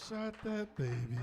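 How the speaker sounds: high, drawn-out exclamation; mock disbelief. X-ray tech staring at bullets, slow high disbelief. Repeats shocked x-ray reaction.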